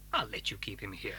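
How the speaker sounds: resigned hush. Acted. Grudging consent, voice dropping.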